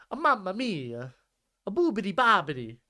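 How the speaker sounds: clumsy cartoon Italian, "mamma mia"; bad Mario impression. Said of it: "Can't do a good Mario impression".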